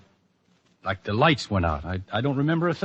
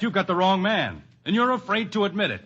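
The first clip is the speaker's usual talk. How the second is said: defiant accusation; 8 semitones higher than usual. Acted. Accused man defies the doctor.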